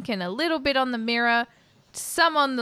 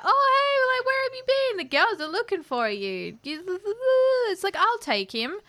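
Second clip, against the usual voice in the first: put-on much higher, sing-song party girl voice; drunk sorority girl; 10 semitones higher than usual. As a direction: gush it like a tipsy sorority girl. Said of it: "She's like".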